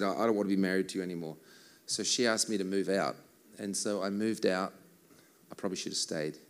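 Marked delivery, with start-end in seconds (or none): 0.0-1.4 fast flat retold quote; quoting wife. Retelling his wife's words, fast. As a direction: rattle off the wife's words quickly. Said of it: "And so she said".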